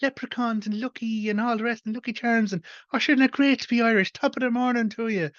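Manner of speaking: a clueless foreigner in a comic panel gushes at an Irishman in a hammed-up Irish lilt; mock stage-Irish. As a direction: parody a tourist gushing about Ireland in a stage-Irish accent. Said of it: "the other individual going".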